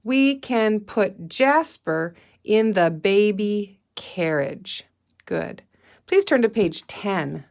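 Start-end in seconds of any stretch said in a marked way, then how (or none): 0.0-4.9 slow, word by word reading; slow read-aloud. Shown as a demonstration. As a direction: read it slowly again for the class. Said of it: "Let's say that sentence one more time".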